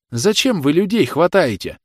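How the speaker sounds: high, loud mother's outcry; agitated protest. A narrator's reading. Agitated mother challenges the officer.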